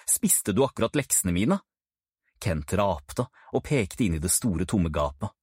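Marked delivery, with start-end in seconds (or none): none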